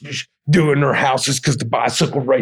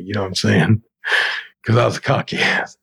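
usual speaking voice

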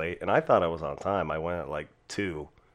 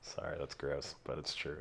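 whispered aside; breathy embarrassed whisper; 6 semitones lower, 15 dB quieter than usual. Real, not acted. Whispered apology.

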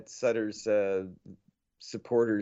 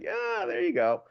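high, slow mock approval; 12 semitones higher than usual. Fan reacting to screaming matches news, high mock approval. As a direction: draw it out high like an amused reaction. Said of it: "I was like".

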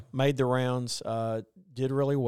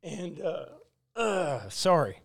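choked-up, halting apology; real tearful hesitation; close to crying; 4 semitones higher, slower than usual. Real, not acted. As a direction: stop to collect yourself, then apologize. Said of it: "way I am now in front of all y'all".

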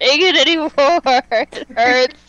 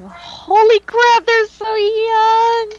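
excited; 8 semitones higher, 3 dB louder, slower than usual. Real, not acted. Fangirling over young idol photos.

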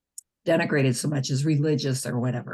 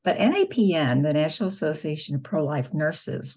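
usual speaking voice

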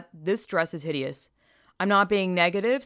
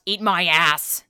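loud, punchy retort; mock all-caps yell; 2 semitones lower, 6 dB louder, slower than usual. Mimicking his all-caps reply, loud mock yell. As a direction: bark it like it's typed in caps. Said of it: "he wrote in all caps".

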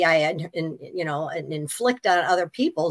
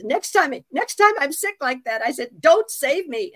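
mock demand; 7 semitones higher, 4 dB louder than usual.

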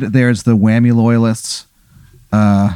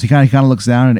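usual speaking voice

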